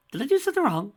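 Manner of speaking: high, loud sing-song mockery; mocking taunt. A narrator's reading. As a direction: taunt him with fake innocence. Villain taunting the enraged dragon.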